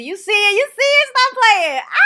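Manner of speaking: high-pitched, very loud excited shouting; hyped up. Real, not acted. Showing off the Cowboys jersey to viewers, hyped-up shouting. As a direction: yell it like a hyped-up superfan. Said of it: "I just get real hype".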